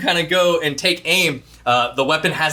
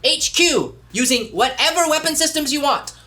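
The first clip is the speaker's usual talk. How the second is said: Alpha's voice; 11 semitones higher than usual. Acted. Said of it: "be like".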